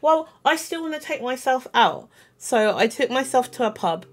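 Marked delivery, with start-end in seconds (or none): 0.0-2.1 defiant. Retold or imitated. Defiant self-date decision.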